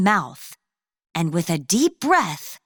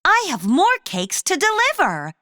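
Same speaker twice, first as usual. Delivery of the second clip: upset; 9 semitones higher than usual. Acted. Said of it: "Clara was upset".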